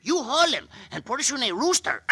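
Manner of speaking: ordinary talk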